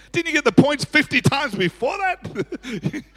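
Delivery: high, drawn-out disbelief; mock incredulous. Pastor's thought at a late compliment, incredulous. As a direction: pitch it up, slow and exasperated. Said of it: "I'm thinking".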